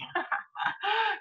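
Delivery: a real sob catching in the throat; breaking into a tearful sob; sudden crying breath; choked sob. Real, not acted. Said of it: "you're making me cry".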